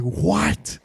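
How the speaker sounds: high, rising shocked question; re-enacted astonished outburst; retold disbelief. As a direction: react as if hearing wild gossip. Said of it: "I just remember being like".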